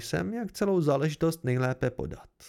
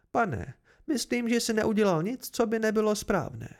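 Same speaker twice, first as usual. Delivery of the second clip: higher-pitched, lightly voiced lady; approving noblewoman; 6 semitones higher than usual. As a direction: address him formally in a raised pitch. Meluan praises the narrator. (lehce zahraná šlechtična, vyšším hlasem)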